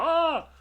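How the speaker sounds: high, loud cartoon yell with a broom; hammed-up janitor hero shout; silly superhero war cry; mock battle cry. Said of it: "il est genre".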